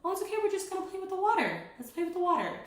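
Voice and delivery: very high, bright coaxing voice; calm the toddler with a sing-song lilt; soothing reassurance. Shown as a demonstration. Mom calming her son on the potty, high and soothing. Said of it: "soothing voice to the child".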